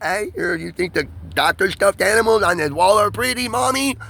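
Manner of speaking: put-on a little kid eagerly offering his mother a gift; lightly voiced young boy; six-year-old child. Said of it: "I am six years old".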